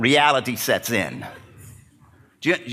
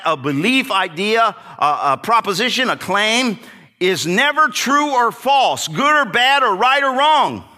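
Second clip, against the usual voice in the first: emphatic preaching; 8 semitones higher, 5 dB louder than usual. Real, not acted. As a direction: drive each word home.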